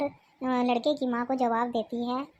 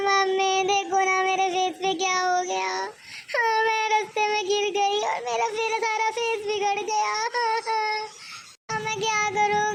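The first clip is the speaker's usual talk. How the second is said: mock crying; 6 semitones higher than usual. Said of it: "ज़ोर ज़ोर से रोने लगती है".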